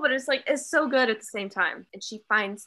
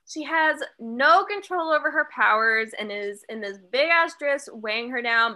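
normal talking voice

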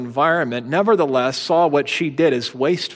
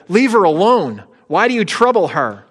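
firm rebuke; 6 semitones higher, 5 dB louder than usual. Retold or imitated. Jesus rebukes the disciples.

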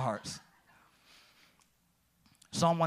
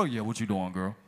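dropped low, smooth and put-on; deep voice; 9 semitones lower than usual. Greeting his future wife on the phone, put-on deep voice. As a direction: go low and smooth like you're flirting. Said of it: "so I tried to change mine".

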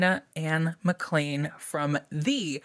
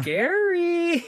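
high loud mock scare; spooky squeal; 13 semitones higher, 4 dB louder than usual. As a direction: squeal it like it's scary. Mock horror reaction.

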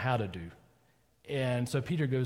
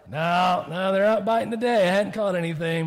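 grumbling fisherman; 4 semitones higher, 5 dB louder than usual. Acted. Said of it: "what does the fisherman say?".

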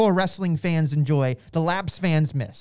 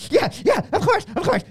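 much higher, hurried yes-man voice; mock eager agreement; 10 semitones higher, 3 dB louder than usual. Imagined Tony answering Vince, high giddy agreement. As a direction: gush along like a wired fanboy. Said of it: "And then Tony's going".

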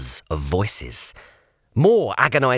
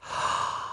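a sharp unvoiced gasp of wonder; gasp of awe; 9 dB quieter than usual. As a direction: catch your breath in amazement. Kip sees the museum.

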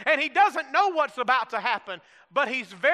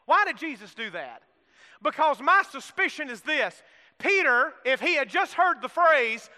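ordinary talk, nothing marked

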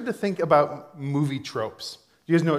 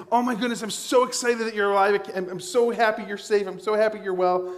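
higher-pitched, gushing relief; relieved excitement; 6 semitones higher than usual. Acted. Family member greets waking hospital patient, excited relief. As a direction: pour out excited relief in one rush. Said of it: "they run up and they say".

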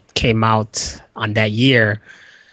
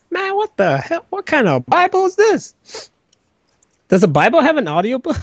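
much higher, comic character voice; high goofy voice; 13 semitones higher than usual. Pretend. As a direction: squeak it out like a silly cartoon. Mock Jesus voice after rising.

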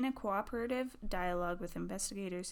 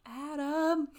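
higher, louder call of a name; 7 semitones higher, 5 dB louder than usual. Retold or imitated. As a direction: call out to someone in the next room. Calling her partner for help.

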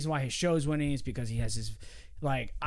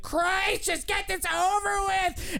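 high, fed-up groan; exasperated Howie; 13 semitones higher, slower than usual. Retold or imitated. Howie to J-Matt, high-pitched fed-up complaint. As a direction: beg for it to be over, pitched up. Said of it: "And Howie's like".

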